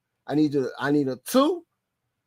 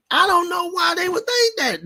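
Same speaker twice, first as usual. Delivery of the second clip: incredulous outburst; 13 semitones higher than usual. Real, not acted.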